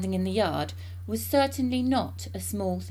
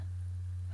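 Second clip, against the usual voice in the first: put-on old woman's cackle; 10 dB quieter than usual. A narrator's reading. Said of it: "cackled the old woman".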